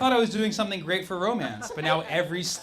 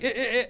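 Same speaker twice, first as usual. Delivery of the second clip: clipped reversed laugh sounds; short choppy mock laugh; backwards laugh; 6 semitones higher than usual. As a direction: mimic a laugh played in reverse. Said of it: "I thought they were gonna laugh like".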